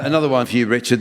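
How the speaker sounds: normal talking voice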